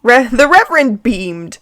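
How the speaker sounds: ordinary talk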